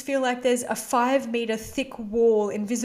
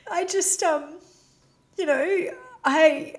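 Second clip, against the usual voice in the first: choked up; 6 semitones higher, 3 dB louder, slower than usual. Real, not acted. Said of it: "I'm so emotional".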